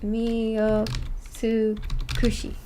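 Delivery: Miyotsukushi very slow, syllable by syllable; slow careful spelling. Shown as a demonstration. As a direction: sound it out slowly while writing. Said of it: "Alternate ending".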